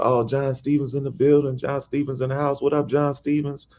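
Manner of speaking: high, hyped crowd greeting; shouting him out. Retold or imitated. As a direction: cheer the arrival. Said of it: "Everybody shouting him out".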